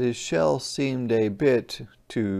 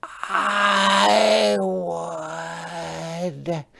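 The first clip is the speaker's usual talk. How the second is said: creaky, higher call; creaky coral voice; 6 semitones higher, 4 dB louder than usual. Acted. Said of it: "creaked a crusty coral".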